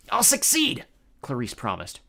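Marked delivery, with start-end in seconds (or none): 0.1-0.8 trembling voice. A narrator's reading. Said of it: "her voice trembling".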